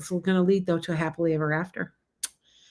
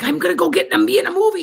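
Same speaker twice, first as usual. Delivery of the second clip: high, louder mock-excited voice; excited daughter; 11 semitones higher, 6 dB louder, faster than usual. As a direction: imitate an eager daughter, pitched way up. Acting out daughter's eager announcement.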